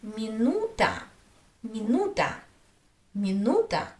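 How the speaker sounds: mi-NU-ta, middle syllable of "minuta" longer; stress on U. Shown as a demonstration. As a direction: lean on the "nu" in "minuta". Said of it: "Where is the stress?".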